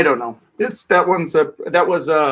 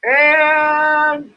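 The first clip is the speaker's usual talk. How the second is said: live play-by-play; 7 semitones higher than usual. Acted. Live race commentary.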